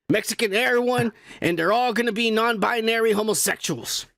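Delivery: normal talking voice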